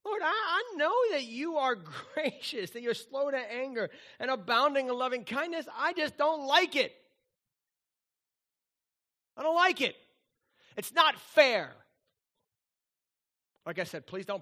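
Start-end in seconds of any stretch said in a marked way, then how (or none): 0.0-11.8 whiny Jonah. Acted. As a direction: whine it like a sulking prophet. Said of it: "that's Jonah".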